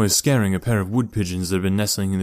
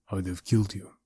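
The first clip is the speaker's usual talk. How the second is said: deadpan; 7 dB quieter than usual. A narrator's reading.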